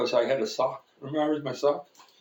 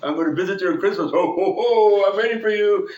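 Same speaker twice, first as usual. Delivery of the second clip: loud, raised jolly Santa voice; imitating Santa; 5 semitones higher, 6 dB louder than usual. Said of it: "He goes / Santa".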